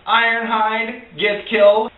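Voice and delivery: ordinary talk, nothing marked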